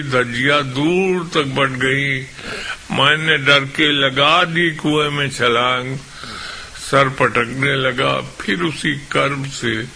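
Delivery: emphatic, declaimed Urdu verse; impassioned poetry recital; poem recitation. Acted. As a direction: ring out each line to a mushaira audience.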